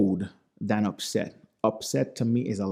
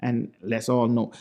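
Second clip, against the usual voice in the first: ordinary talk, nothing marked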